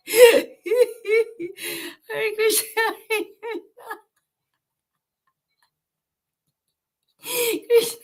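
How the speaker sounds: overwhelmed ecstatic sob-laugh; crying laugh. Real, not acted. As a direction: break into laughing tears. Again overcome while chanting.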